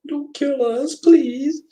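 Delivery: high-pitched, put-on pleading; mock scared. Imitating a scared film character begging for their lives, mock scared. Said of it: "She's scared".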